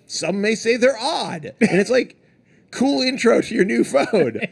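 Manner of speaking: high, slow mock-bewildered presenter; lightly voiced puzzled presenter. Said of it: "she was like".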